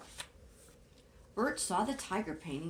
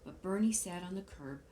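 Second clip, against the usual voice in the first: ordinary delivery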